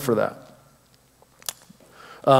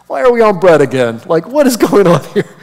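louder, higher mock bafflement; comic exasperation; 5 semitones higher, 5 dB louder than usual. Jesus to disciples stuck on bread, comically exasperated. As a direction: protest incredulously, pitch climbing.

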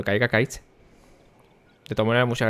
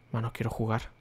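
much softer, under his breath; muttered; 11 dB quieter than usual. Real, not acted. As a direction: mutter it to yourself, impatient. Impaciente por entrar al juego. (murmurado con impaciencia)

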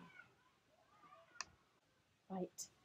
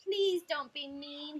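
slow, higher-pitched timid plea; bashful; 5 semitones higher, 4 dB louder, slower than usual. Acted. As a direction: plead shyly, drawing it out. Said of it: "he said bashfully".

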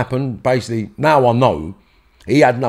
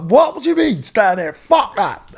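high, incredulous retelling of a furious reply; retold outraged shout; 11 semitones higher than usual. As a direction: throw it back at them, half-shouting, indignant. Retold row with the bouncers.

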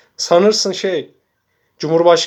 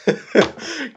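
laugh; 8 semitones higher than usual. Real, not acted. Laughs at own mockery.

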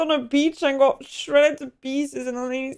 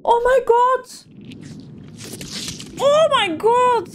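horrified exclamation; 11 semitones higher than usual. Real, not acted. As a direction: blurt it out in horror at the screen. Reacting to brutal beach landing.

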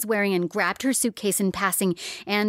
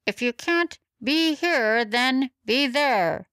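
much higher, slow squeak; squeaky megaphone; 6 semitones higher, slower than usual. Acted. Megaphone squeaking its advice, high and slow. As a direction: squeeze it out like a squealing speaker. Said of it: "The megaphone squeaked and said".

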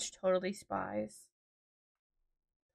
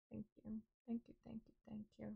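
much softer, murmured thank-yous; quiet thanks; 21 dB quieter, much faster than usual. Real, not acted. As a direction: thank them almost under your breath. Touched thanks for condolences.

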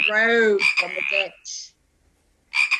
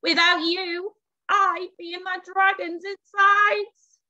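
high-pitched, crowing witch; grateful crowing; 10 semitones higher, 3 dB louder than usual. A narrator's reading. Rescued witch to her animal friends, gratefully crowing. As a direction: crow it high with storybook gratitude. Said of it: "the grateful witch crowed".

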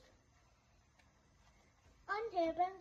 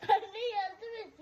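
slow laughing plea; 5 semitones higher, 6 dB louder, slower than usual. Real, not acted. Kid pleads, laughing and crying.

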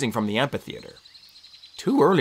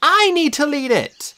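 put-on high, slow girl's voice; high, determined girl; insistent Annabeth; 4 dB louder, slower than usual. A narrator's reading. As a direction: claim the lead firmly. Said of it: "Annabeth said".

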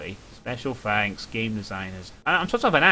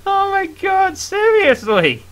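high, helpless laughter through the words; shriek-laugh at the jump scare; laughing in fright; 4 dB louder than usual. Real, not acted. Gamer caught by a jump scare, laughing high. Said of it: "I'm crying out of fear".

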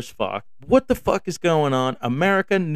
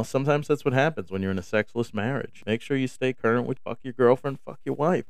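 ordinary talk, nothing marked

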